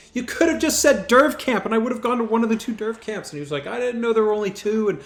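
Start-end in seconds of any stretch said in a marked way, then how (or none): 0.1-3.3 high-pitched, insistent reproach; exasperated arguing. Retold or imitated. Acts out arguing with his buddy. 3.7-5.0 making excuses. Retold or imitated. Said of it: "coming up with all these excuses".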